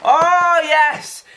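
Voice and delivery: breathy, very high excited laugh into a loud "yes"; giddy squeal laugh. Real, not acted. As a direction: laugh with pure delight, then cheer. Finding more goodies in bags.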